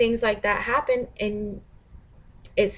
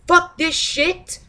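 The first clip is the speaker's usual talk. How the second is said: mock angry; 7 semitones higher, 4 dB louder, slower than usual. Quoting angry conscious people.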